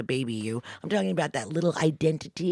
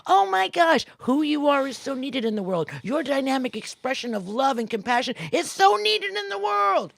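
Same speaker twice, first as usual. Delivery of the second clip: encouraging inner voice; 8 semitones higher, 6 dB louder than usual. Acted. The kind inner voice cheering the listener on, in loud high praise. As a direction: lift them up with big joyful praise. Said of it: "Listen to the voice that says".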